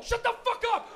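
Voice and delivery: high, loud acted outburst; angry shout. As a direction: shout your panicking friend down. Friend snaps in acted car panic.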